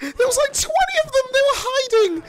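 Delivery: high panicked yelling; panicked shout. Real, not acted. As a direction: shriek in alarm. Ambushed by many enemies.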